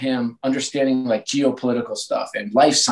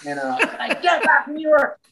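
laughing. Real, not acted. Laughing retelling the phone call.

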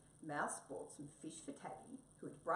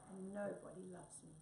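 soft, dropping sad sigh of a line; stray cat telling Mouse nobody loves him; 2 semitones higher, 4 dB quieter than usual. Acted. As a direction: let the sadness fall away at the end. Cat confesses sadly.

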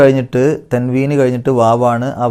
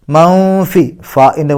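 higher, louder chanted word; Quran recitation; 4 semitones higher, 4 dB louder, much slower than usual. Shown as a demonstration. Teacher recites the next word aloud. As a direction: ring it out as recitation. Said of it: "സുക്കൂണിന് ഇഖ്ഫ ചെയ്യുക".

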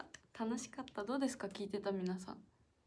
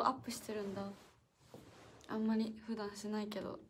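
normal talking voice

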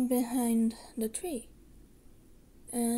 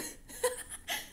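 high breathy laugh; 11 semitones higher, 4 dB quieter than usual. Acted. Laughs after long gone remark.

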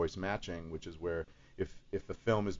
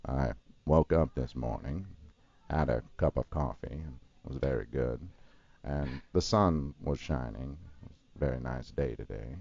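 Morgan Freeman impression; 6 semitones lower than usual. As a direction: tell it like a calm old storyteller. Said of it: "he's very kind of relaxed and calm".

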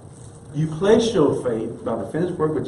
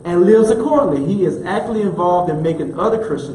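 rising, louder preaching cadence; 3 semitones higher, 4 dB louder than usual. Acted. Pastor preaching hard to the congregation. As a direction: preach it with building fire. Preacher builds to full force.

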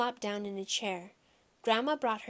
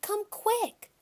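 put-on high, urgent kid's call; high-pitched summons across the house; hollering; 12 semitones higher than usual. A narrator's reading. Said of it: "I hollered".

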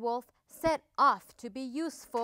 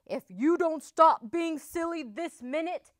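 loud, raised, scolding roar; roared threat; 6 semitones higher, 7 dB louder than usual. A narrator's reading. Hurt wolf scolding the panicking duckling, loud roar. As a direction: roar it as an exasperated warning. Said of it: "he roared".

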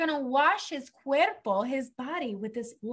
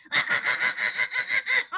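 high shrieking laugh; 10 semitones higher than usual. Real, not acted. Laughs at smelly duck.

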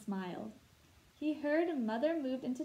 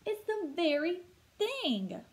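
much higher, slow joyful exclaim; 7 semitones higher, 4 dB louder than usual. Acted. A little girl sees the lost lamp, high and thrilled. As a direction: cry it out with delight. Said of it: "Sally exclaimed".

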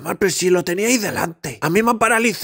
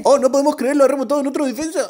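excited commentator; 8 semitones higher, faster than usual. Acted. Mock commentator hype.

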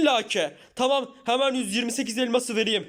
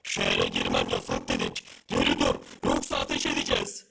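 deep guard voice; 4 dB quieter than usual. Acted. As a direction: sound like a machine-voiced gatekeeper. Guard warning to fire.

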